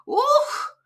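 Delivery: high, loud squeezed grunt; boy grabbed and dragged by a giant; squeezed oof. Acted. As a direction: get the wind knocked out.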